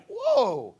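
high-pitched, loud "whoa"; mock-amazed exclamation; delighted surprise. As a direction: act pleasantly bowled over. Acts delighted surprise at hate.

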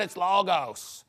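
slow mock Greek accent; put-on, affected Greek accent; fake Greek accent. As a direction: stretch "logos" in a phony Greek accent. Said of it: "just like your fake accent".

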